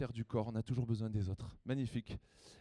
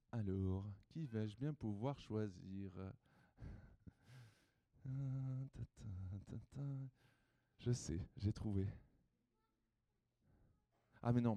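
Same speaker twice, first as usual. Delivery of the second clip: quiet aside; 4 semitones lower, 8 dB quieter than usual. Real, not acted. Quiet aside while choosing someone.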